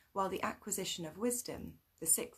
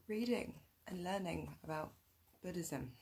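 normal talking voice

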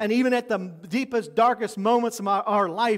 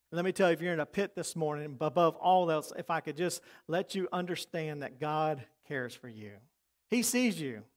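tender appeal; 5 semitones lower, 8 dB quieter than usual. Real, not acted. Pastor's tender appeal to listeners.

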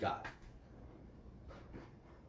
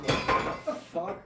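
mock shock; 11 semitones higher, 7 dB louder, slower than usual. Retelling his own reaction.

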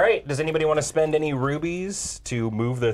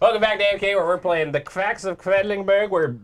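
put-on German accent; 6 semitones higher, 3 dB louder than usual. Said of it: "You did that accent so under your breath".

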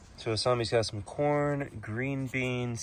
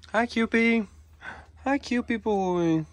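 much higher, louder sing-song greeting; 12 semitones higher, 4 dB louder than usual. Real, not acted. Greeting the cockatoo.

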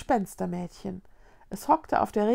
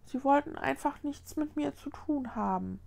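soft, higher, hurt voice; bitter; 5 semitones higher, 6 dB quieter than usual. A narrator's reading. Boy tells how ghosts shunned him, softly bitter. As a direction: say it small and wounded. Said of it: "sagte der Junge bitter".

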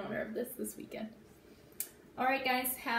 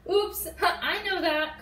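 Oops called out higher, slow and loud; cried out; 7 semitones higher, 6 dB louder, slower than usual. Acted. Froggy crying out to his sister, high and loud. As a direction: cry it out as Froggy. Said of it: "cried Froggy".

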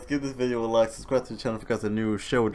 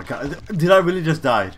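shocked disbelief; 6 semitones higher, 9 dB louder than usual. Real, not acted. Dies in game.

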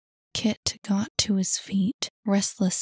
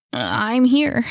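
louder, mildly breathless call; gasped; 3 semitones higher, 5 dB louder than usual. A narrator's reading. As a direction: gasp it out with some effort. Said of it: "he gasped".

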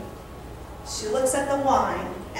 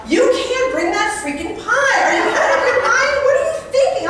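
higher and higher, laughing, mock-outraged; blurted outburst; 11 semitones higher, 4 dB louder than usual. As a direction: play the friend exploding in disbelief. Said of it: "she blurts out".

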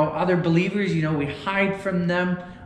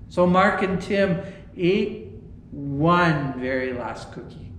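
usual speaking voice

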